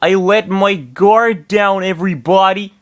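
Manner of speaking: sorry to let everyone down; disappointment. Acted. Roy apologizes to the team, disappointed. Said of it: "said in disappointment".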